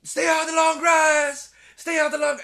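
high, loud mock yell of warning; urgent shout. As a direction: bark the warning across the field. Right-hand man yelling warning.

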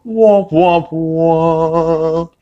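high, sung womp womp womp; mimicking a sitcom sad trombone. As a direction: imitate a sad trombone effect. Sad trombone sound effect.

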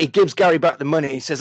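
ordinary talk, nothing marked

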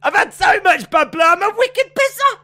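high, gleeful shout of "wicked pisser"; proud shout. Pretend. Co-host gleefully shouts the Boston slang. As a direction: belt it out proudly.

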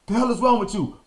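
a hint of a furious dad mid-whooping; retold angry father. Father scolding his son during the whooping, a mock angry yell.

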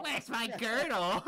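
high, loud, slow put-on woman's voice; mock lady voice. Dipper echoes the girdle line in a high, loud lady voice. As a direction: squeal it like a flustered lady.

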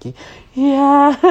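very high, loud squeaky stutter; stammered yes. Retold or imitated. Answering mom, high squeaky giggly yes. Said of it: "And I was like".

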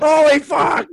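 high, loud cry of disbelief; hammed-up horrified shout; mock shout. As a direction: yell it out in comic shock. Riffing on absurd scissors scene.

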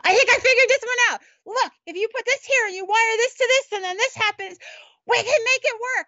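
high, eager shout of discovery; retelling a friend running up with an excited plan; excited scream. Retold or imitated. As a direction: pitch up, bursting with the idea. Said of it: "he'd scream".

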